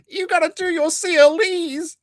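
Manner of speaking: high, squeaky mock tantrum; mocking yell. Host mocks Nick yelling at the lawyer, squeaky and high. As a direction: shriek it like a whiny child. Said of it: "go up behind somebody and yell at them".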